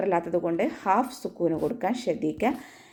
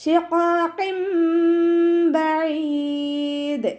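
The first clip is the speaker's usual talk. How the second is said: slow, high, melodic tajweed chant; Quran recitation; 9 semitones higher, 4 dB louder, much slower than usual. Shown as a demonstration. Teacher chants the verse for students, slow and melodic. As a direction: draw each syllable out in a sung recitation.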